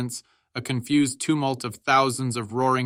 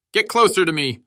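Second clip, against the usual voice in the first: high, loud, urgent yell; fierce battlefield shout; shouted order; 7 semitones higher, 7 dB louder than usual. A narrator's reading. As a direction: shout it at a panicking comrade. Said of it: "Hal shouted".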